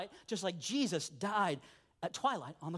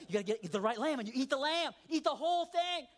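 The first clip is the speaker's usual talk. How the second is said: usual speaking voice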